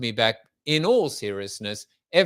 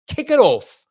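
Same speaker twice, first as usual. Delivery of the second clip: higher, louder shout to kick off the day; loud rallying call; 8 semitones higher, 10 dB louder than usual. Real, not acted. Host rallying listeners to start their day, loud. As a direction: punch it out like a rally cry.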